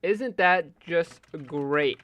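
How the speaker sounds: loud, drawn-out bitter sarcasm; exasperated sarcasm. Real, not acted. Streamer griping that it freezes when needed, loud sarcasm. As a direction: stretch it out in mock delight.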